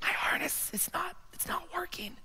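high, strained whisper; tiny secretive squeak of breath; barely audible whisper. Acted. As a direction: whisper it so nobody else gets scared. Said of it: "You're gonna have to speak up".

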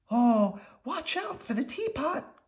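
high, drawn-out warning; dismayed storybook warning; alarmed cry. A narrator's reading. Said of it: "cried Maria".

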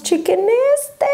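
high, drawn-out squeal of delight; excited showing-off. Real, not acted. Vlogger showing viewers a gorgeous swatch, high excited delight. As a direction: stretch it out high, thrilled to show it.

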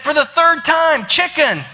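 high-pitched, acted exasperated yell; exasperated shouting. Wife answering her husband for the third time, exasperated shout. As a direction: shout the punchline as the fed-up one.

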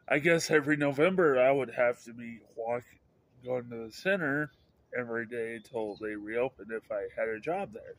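usual speaking voice

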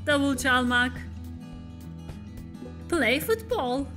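ordinary talk, nothing marked

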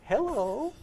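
high, sing-song sweet hello with rising end; sanctified phone hello. Retold or imitated. Husband answering the phone mid-argument, suddenly sweet hello. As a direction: put on an angelic telephone greeting. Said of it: "such sanctifying power in a telephone".

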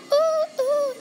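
high hooting. Acted. Teasing hoots.

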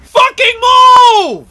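furious high shouting; raging gamer. Real, not acted. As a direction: scream at the screen. Yelling at game character.

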